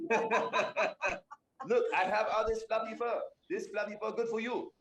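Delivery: high, giggly fluffy cat boast; laughing high Thunder. Acted. Thunder boasts of soft fur.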